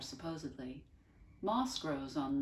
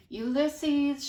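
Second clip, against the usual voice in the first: loud calling shout, high; shouting a name into the night; 11 semitones higher, 12 dB louder than usual. A narrator's reading. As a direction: call out into the dark. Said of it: "shouted Tootie".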